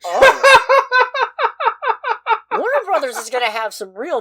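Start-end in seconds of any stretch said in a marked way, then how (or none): 0.1-0.4 much higher and louder gasp; mock stunned oh. Host replaying his own reaction in the cinema, loud stunned oh. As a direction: act floored by the joke. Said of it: "And I just went".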